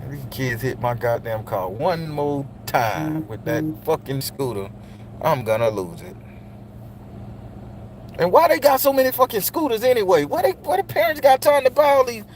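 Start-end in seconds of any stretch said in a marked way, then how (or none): 0.1-6.2 muttered grumble. Real, not acted. Muttering irritated at kids' scooters.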